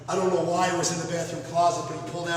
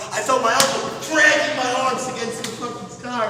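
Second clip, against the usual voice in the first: normal talking voice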